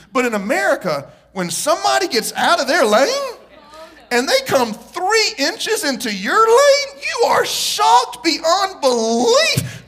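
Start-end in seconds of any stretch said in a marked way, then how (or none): 1.3-9.8 rising higher, louder and slower to shocked; mock shock, higher and louder; mock outrage building. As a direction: whip up comic outrage. Mock outrage at American drivers.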